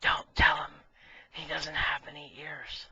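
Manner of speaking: secret whisper in the ear; conspiratorial whisper to the last guy. Acted. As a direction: lean in and warn him in a whisper. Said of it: "he whispers in his ear".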